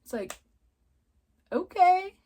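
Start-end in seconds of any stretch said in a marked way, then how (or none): 1.5-2.2 high, drawn-out, weirded-out okay; awkward "okay". Retold or imitated. Reacting to babies calling strangers Mommy and Daddy, weirded out. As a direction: say it loud and high, thrown by what you see.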